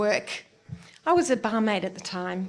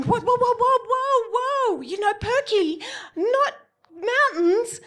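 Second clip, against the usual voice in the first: high, loud, panicked yelping; 12 semitones higher, 3 dB louder than usual. Retold or imitated. Waking from surgery, calling the nurse in panic. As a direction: squeal in half-laughing alarm. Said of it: "I'm going".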